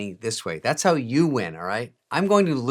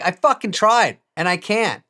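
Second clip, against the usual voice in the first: louder frustrated retort; 8 semitones higher, 6 dB louder than usual. Retold or imitated. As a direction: snap back at pushy apps. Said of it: "I'm like".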